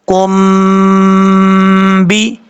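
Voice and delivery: کم بی with the م held in a nasal hum for one alif; ghunna demo. Shown as a demonstration. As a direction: hum the م of کم بی through the nose. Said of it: "ایک الف کی مقدار غنہ کر کے پڑھیں گے". (کم بی میں غنہ کے ساتھ م کو ایک الف لمبا کھینچیں)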